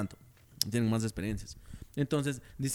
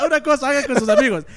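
much louder and higher, pressing the point; loud excited; 9 semitones higher, 10 dB louder than usual. Real, not acted. Friend urging his buddy, loud and high. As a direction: push it hard, louder and higher.

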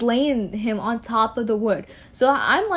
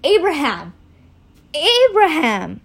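drawn-out angelic summons; mildly projected, stretched call; calling from heaven; 5 semitones higher, 5 dB louder, slower than usual. A narrator's reading. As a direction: call the name out from on high. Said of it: "called to them from the heavens".

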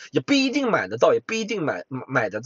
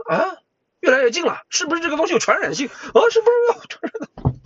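much higher, rising mock panic; 14 semitones higher, 4 dB louder than usual. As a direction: freak out in a comic high voice. Imagined panicking listener. (高声夸张地惊慌)

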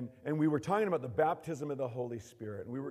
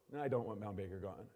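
normal talking voice

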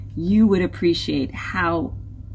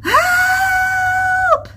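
very high, loud cry for help; panicked scream; 6 dB louder than usual. A narrator's reading. Reef creatures gripped by fear of the whale, screaming. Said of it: "they all screamed, gripped by panic and fear".

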